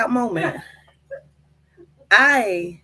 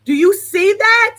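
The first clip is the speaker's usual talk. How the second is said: loud, high, amazed question; excited; 8 semitones higher, 8 dB louder than usual. Real, not acted. Vlogger showing the tongue to viewers, loud excited. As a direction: pull the viewer in, thrilled.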